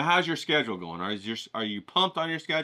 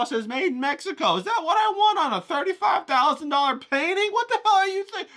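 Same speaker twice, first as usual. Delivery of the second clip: much higher, louder indignant tirade; outraged rant; 12 semitones higher, 4 dB louder than usual. Acted. Critical eye tearing into the dealer, high and outraged. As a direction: rail at him in a high incredulous voice.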